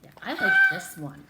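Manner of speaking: squealed high and loud; excited. Real, not acted. Teacher picking her favorite page, high excited squeal. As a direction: burst out with delight.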